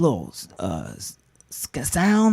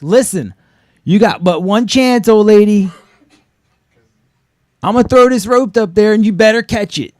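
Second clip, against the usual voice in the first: loud, higher tough-guy instructions; commanding call-up; 7 semitones higher, 8 dB louder than usual. Retold or imitated. Rescuer calls up to the trapped old lady, loud and commanding. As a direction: bark it like a hero in an action movie. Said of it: "So I'm like".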